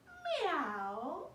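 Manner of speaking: high, loud meow; puppy meowing. Acted. Puppy George answering his mother, high meow. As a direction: meow like a little cat. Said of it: "George went".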